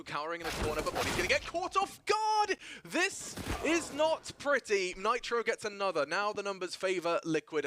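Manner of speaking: loud, high, fast hype commentary; live play-by-play. Acted. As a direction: call the fight like an esports caster.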